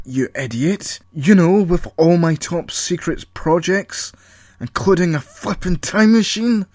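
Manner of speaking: high, loud escalating tirade; Paul ranting at Colin about his lab; paranoid rant. Acted.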